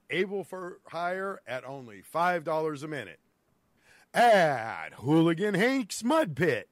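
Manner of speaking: ad read. Acted. Reads a mock mud pit ad.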